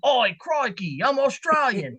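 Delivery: a lame, on-purpose fake Australian twang; deliberately bad Australian accent. Shown as a demonstration. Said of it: "if I went and I was like".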